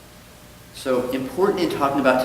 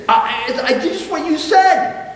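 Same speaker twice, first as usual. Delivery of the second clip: much higher and louder stammering plea; flustered plea; 7 dB louder than usual. Acted. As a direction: stammer in panic. Goldstein pleading, joke.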